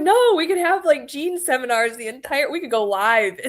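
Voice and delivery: high, laughing retold enthusiasm; excited pleading. Urging a friend to ride along and go live, excited. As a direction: burst in high, then tumble on excitedly. Retelling her excited plea to Gene.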